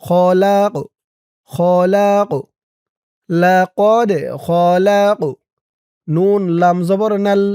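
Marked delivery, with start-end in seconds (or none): 0.0-2.3 "খলাক" with an echoed, popped final q; qalqalah bounce. Shown as a demonstration. Teacher demonstrating qalqalah, খলাক with a pushed final q. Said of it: "এই কফটাও কলকলার হরফ". 3.3-5.2 slow "লাকদ খলাক", bouncing the final d and q; qalqalah recitation. Shown as a demonstration. Teacher joining both words, bounced endings in লাকদ খলাক. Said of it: "ধাক্কা দিয়ে পড়তে হবে".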